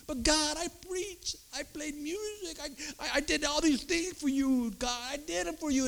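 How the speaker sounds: high, pleading protest; a believer pleads his good deeds, high and pleading. Retold or imitated. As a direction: plead your good works to God.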